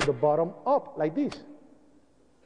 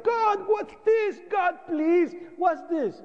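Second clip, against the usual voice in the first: much higher, slow cry out to God in horror; anguished cry to God; 12 semitones higher, slower than usual. Retold or imitated. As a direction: cry out at the sight of the bodies. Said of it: "I started crying".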